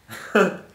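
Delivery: short laugh. Real, not acted. Laughs at own story.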